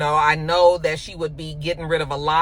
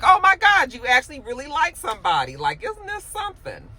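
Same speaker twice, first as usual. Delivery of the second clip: squealing high, teasing gush; 7 semitones higher than usual. Retold or imitated. Coworker teasing a smitten friend, high giddy gush. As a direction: tease him like a giddy coworker. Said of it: "she's teasing him".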